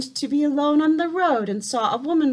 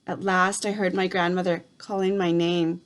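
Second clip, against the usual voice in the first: ordinary delivery, nothing marked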